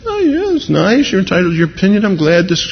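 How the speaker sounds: high, mock-polite humoring; patronizing politeness. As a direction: humor the believer while keeping your distance. Acting out patronizing polite listener.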